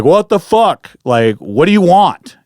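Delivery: high, loud exasperated yell; retold yell. Camper demanding answers from the thing by the creek, retold yell. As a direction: shout a challenge, slowing on the demand. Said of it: "and I yell again".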